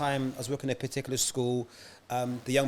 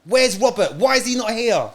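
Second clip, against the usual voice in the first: retold shout, loud and pitched up; mum shouting; 9 semitones higher, 10 dB louder than usual. Mum demanding the missing teacher at a meeting, shouting. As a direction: shout it as the angry parent demanding answers. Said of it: "So the mum started shouting".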